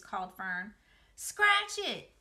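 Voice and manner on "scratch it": put-on high, loud yell from a swinging boy; Avery yelling to his sister from the swing, high and loud. A narrator's reading. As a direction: holler it back while flying past. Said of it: "yelled Avery".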